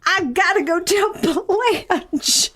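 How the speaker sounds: high-pitched, drawn-out Southern belle; Southern belle accent. Acted. Improvised witness eager to tell Blanche, Southern accent. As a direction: ham up the impatient Southern belle. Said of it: "our Southern Belle accents".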